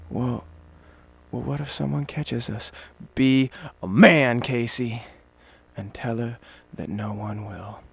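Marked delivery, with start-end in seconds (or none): 0.1-2.7 put-on soft, hushed worried voice of the girlfriend; a half-voiced nervous girlfriend, hushed; girlfriend's hesitant question. Girlfriend fretting about getting caught, softly hushed. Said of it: "she might say".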